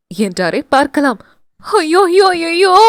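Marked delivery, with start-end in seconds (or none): 1.6-2.7 high, wailing cries of dismay; panicked wail. Acted. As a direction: cry out as if beating your chest. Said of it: "நெஞ்சில் அடித்துக்கொண்டே".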